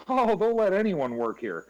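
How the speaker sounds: high, loud jokey one-liner; mock self-deprecating quip. Imitating coworker's joke to each new colleague, hammed-up. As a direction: sell the corny joke hard. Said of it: "And he goes".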